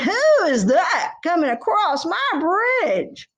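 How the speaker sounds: troll voice. Acted. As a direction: drag it out like a troll.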